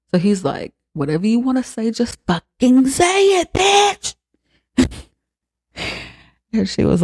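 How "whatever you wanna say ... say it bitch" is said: high-pitched mock outburst, climbing to the last word; acted angry demand. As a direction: imitate someone exploding at the dinner table. Retold angry dinner-table confrontation.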